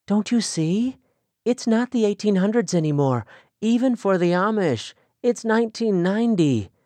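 put-on young woman longing for the world, excited. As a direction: pour out her dreams with growing eagerness. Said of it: "there was excitement in her voice".

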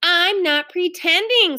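high, drawn-out protest, mildly yelled; screamed protest. A narrator's reading. Ramona denies pretending to Beezus, mild scream. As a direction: insist with a mild mock scream. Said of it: "screamed Ramona".

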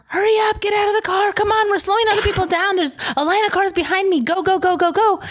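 high, loud urgent yell; yelling at kids. Retold or imitated. Mom yelling at her kids to get out fast, loud and high. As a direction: rush the kids out of the car. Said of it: "I'm yelling at them".

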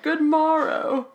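slow, high posh English "Good morrow"; posh British accent. Retold or imitated. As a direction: stretch it out like an old English gentleman. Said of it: "I think they say".

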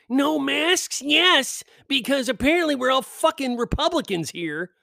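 high sneering mock voice; mocking invite line.

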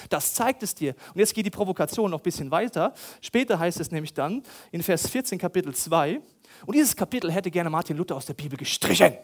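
usual speaking voice

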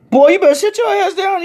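loud, very high-pitched put-down; shrieked mock telling-off; mock scolding order. Family jokingly orders guest to sit.

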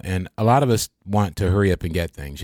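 ordinary delivery

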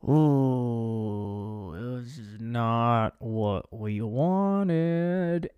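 very slow, drawn-out low cringe; uneasy DJ. Retold or imitated. DJ reacts as the call falls apart, slow mock-awkward groan. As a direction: stretch every word out in mock dismay. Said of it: "the D J's like".

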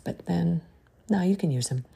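ordinary talk

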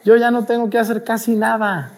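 high-pitched, self-satisfied devil; gleeful devil. Acted. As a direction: crow happily as an idle devil. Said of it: "el diablo feliz de la vida, diciendo".

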